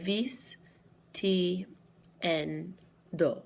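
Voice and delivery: vis... ti... en... do, one at a time; syllable by syllable. Shown as a demonstration. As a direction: break the word into separate syllables, a pause after each. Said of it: "the second to last syllable".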